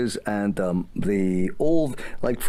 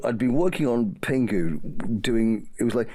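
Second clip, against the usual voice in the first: ordinary delivery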